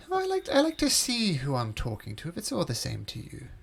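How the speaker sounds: high-voiced host, trailing down at the end; coaxing host. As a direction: politely insist in a high, swooping host voice. Host answers the wary players.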